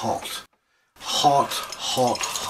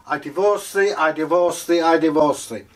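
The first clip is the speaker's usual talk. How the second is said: high, loud, formal declaration; a wife publicly proclaiming divorce; declaring divorce; 4 semitones higher, 3 dB louder than usual. Acted. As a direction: proclaim it to the marketplace.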